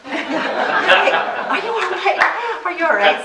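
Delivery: high, slow, laughing mock concern; nice Springfield folks. Springfield people asking if she's all right, high mock-sweet concern. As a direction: imitate kindly locals asking if she's okay, laughing. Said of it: "They're very nice people in Springfield".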